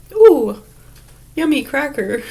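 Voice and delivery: high, loud "ooh" easing into relish; playful, high-pitched yum; delighted snacker. Pretend. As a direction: coo over a tasty treat. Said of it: "But if I just say".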